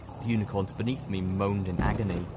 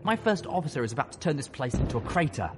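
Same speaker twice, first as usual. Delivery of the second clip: higher-pitched urgent plea; beg, voice raised in pitch; pleading; 6 semitones higher than usual. A narrator's reading. Colonel warns of coming bombardment, pleading.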